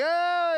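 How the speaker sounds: loud high mock crowd roar; a shouted crowd clamor; crowd noise. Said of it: "there's just the crowd noise".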